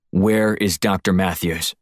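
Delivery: slow and deliberate, each word weighted; slow threat. Acted. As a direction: spell it out slowly as the last warning. I'm only going to ask you once more.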